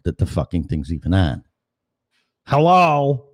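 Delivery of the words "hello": a loud, high call of hello into the mic. Real, not acted. Host calling out to listeners at the start, loud and high. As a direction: call out to check whether anyone is there. Testing whether anyone is listening.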